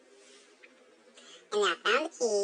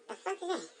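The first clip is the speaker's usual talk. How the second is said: soft, higher-pitched puzzled reaction; hushed disgust; 9 semitones higher, 8 dB quieter than usual. Acted. Hiding and smelling something nasty, hushed disgust. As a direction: recoil quietly, pitched up.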